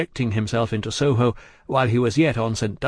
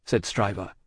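ordinary delivery, nothing marked

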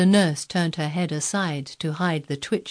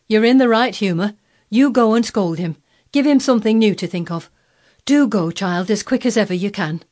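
louder, higher, pressing nurse; urgent urging; 5 semitones higher, 7 dB louder than usual. A narrator's reading. Nurse urging child to go scold him, urgent. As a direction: egg the child on, hurry her along.

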